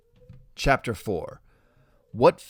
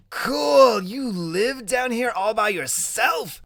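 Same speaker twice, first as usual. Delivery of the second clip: Cool much higher and louder, then slow; delighted surprise; 11 semitones higher, 4 dB louder, slower than usual. Acted. Boy genius sees friend's basement room, delighted. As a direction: gasp at the room like a kid.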